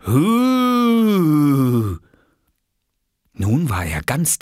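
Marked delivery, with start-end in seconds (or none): 0.1-2.0 long loud "hooo" sliding downward; a bigger, lower-pitched spook howl; deeper ghostly hoot. Acted. Oskar creeping closer to Lars, deeper ghostly hoot. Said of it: "lauter und mit einer noch tieferen Stimme".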